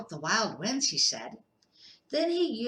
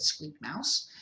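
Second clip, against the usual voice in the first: ordinary delivery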